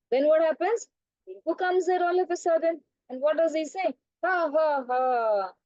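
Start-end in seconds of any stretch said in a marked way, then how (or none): 4.2-5.5 drawn-out fake "ha, ha, ha"; hammed-up teasing laughter; mock laugh. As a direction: laugh mockingly like a teasing monkey. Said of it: "He's laughing and laughing".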